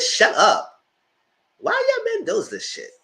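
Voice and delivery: loud "Shut up" rising into a high, exasperated question; loud exasperated clapback; agitated retort. Retold or imitated. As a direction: go off at him, fed up. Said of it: "I was so agitated".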